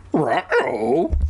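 cartoon dog's worried yelp; Scooby-Doo. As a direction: say it like the cartoon Great Dane. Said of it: "Scooby-Doo-like".

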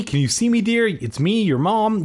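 high, coaxing mother's voice; worried mom. A narrator's reading. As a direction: check on your child in a high voice. Voices mother over injured child.

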